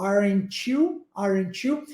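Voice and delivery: "Aren't you" run together as "aren-chew"; T as tch. Shown as a demonstration. Teacher shows the English sound change in "Aren't you", T as tch. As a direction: turn the T of "Aren't" into "tch" before "you", rising at the end. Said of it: "E aqui no inglês a mesma coisa".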